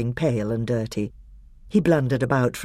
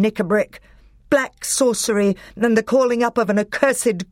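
thundering king; 8 semitones higher than usual. A narrator's reading. Caspian denouncing Nickabrick, thunderous anger. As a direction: confront the traitor with royal anger. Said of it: "rose the voice of King Caspian like thunder".

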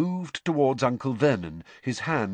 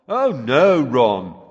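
very sarcastic; 8 dB louder, much slower than usual. A narrator's reading. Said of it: "Fred's voice very sarcastically".

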